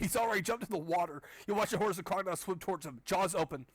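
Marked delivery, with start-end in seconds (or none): none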